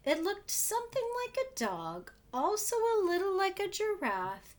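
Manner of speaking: wonder. A narrator's reading. Describing the funny thing.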